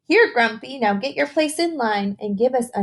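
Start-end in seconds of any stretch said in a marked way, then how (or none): none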